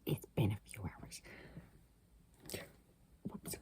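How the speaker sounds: hushed whisper so others won't hear. Real, not acted. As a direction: whisper to not wake the house. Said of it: "the part where I have to whisper".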